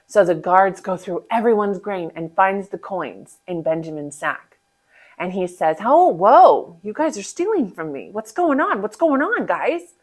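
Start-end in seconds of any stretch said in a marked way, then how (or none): 5.8-10.0 mock shocked. Joseph accuses the brothers.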